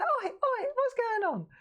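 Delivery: very high laughing "Oi, oi"; mock scold. Telling off the kitten on Dave's jacket, very high mock scold. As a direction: squeak a mock telling-off at the cat.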